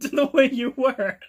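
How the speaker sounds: loud, high, cracking up through the line; helpless laughter breaking into speech; laughing. Real, not acted. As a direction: lose it while acting the quote. Cracks up during acted quote.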